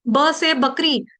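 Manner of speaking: plain ब in बकरी, no puff; careful ब to open बकरी; unaspirated ब. Shown as a demonstration. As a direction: say बकरी with a clean "b". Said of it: "इस तरह से".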